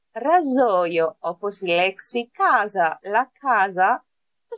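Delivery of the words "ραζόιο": "Ραζόιο" slowly, with a buzzing z; S voiced as Z. Shown as a demonstration. Teacher models razor in Italian, slow z pronunciation. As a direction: draw out "Ραζόιο", z between the vowels. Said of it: "τότε προφέρεται Z".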